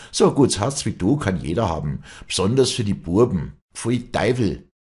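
acted hateful taunt; erupting hatred. A narrator's reading. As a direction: spit it out with contempt. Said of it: "mit ausbrechendem Hass".

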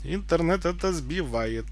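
ordinary delivery

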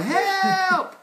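high, loud drawn-out cry; yelling for help. A narrator's reading. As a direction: cry out as the slipping story beast. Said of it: "he's still yelling".

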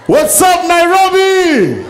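high, loud stretched greeting; crowd greeting shout. Real, not acted. Comedian greeting the Nairobi crowd, loud slow shout. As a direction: call out to the whole room.

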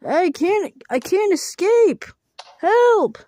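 high, loud and slowing desperate plea; panicked cry for help. Acted. Trapped character begs others for help, high loud panic. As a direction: yell for help as if stuck.

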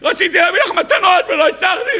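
high, laughing fake wail; playful mock sob over missing gifts; mock crying lament. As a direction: ham up a tearful complaint through laughter. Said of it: "אני בוכה".

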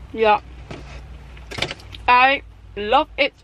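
ordinary delivery

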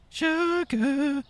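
playful falsetto "Sugar"; squeaky silly voice. Pretend. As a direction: squeak "Sugar" like a cartoon mouse. Repeats the word in a squeak.

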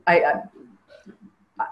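real catch in the voice, words breaking off; fighting back tears; stalled, emotional restarts; choked up. Real, not acted. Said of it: "I don't wanna get emotional".

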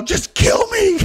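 high, loud mock-hysterical cry; melodramatic plea. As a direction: imitate her wailing dramatically. Imitates her hysterical plea.

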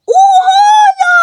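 loud, high stretched oha; amazed exclamation. Real, not acted. Player reacts to the clever hiding spot, amazed shout. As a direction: burst out in disbelief.

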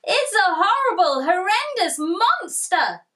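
high, loud squeal. Acted. Tiger squeals in fright at the shadow, high and loud. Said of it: "squealed Tiger".

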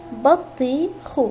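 بطيخ split slowly into بَ طِي خْ; teacher sounding out بطيخ for children; syllable by syllable. Shown as a demonstration. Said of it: "حرف الباء جاء مفتوحا".

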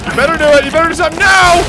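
high loud demanding shout; loud urgent yell. Real, not acted. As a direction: yell at him to act now. Yelling at character to act.

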